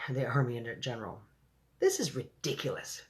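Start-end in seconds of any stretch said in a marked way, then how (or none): none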